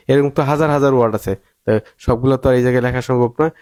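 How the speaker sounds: ordinary talk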